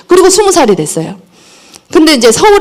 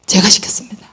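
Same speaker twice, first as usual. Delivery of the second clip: whispered confession; 3 semitones lower, 5 dB quieter than usual. Real, not acted. Confessing own fault to audience.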